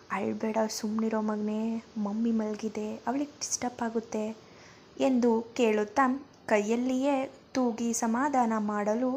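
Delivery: steady storyteller reading a family tale; gentle audiobook narration; story narration. A narrator's reading. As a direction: read it like a bedtime chapter. Reads story chapter aloud.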